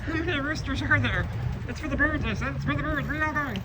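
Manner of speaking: high, squeaky voice talking to the roosters. Acted. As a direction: squeak it out like a little cartoon bird.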